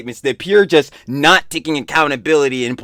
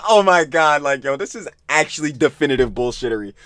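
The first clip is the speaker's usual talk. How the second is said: ordinary delivery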